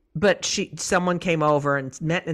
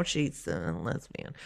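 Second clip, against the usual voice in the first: much lower, softer and slow on the taboo word; scandalized hush; 12 semitones lower, 11 dB quieter than usual. Retold or imitated. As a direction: drop the voice as if it's a secret. Mother naming a taboo word.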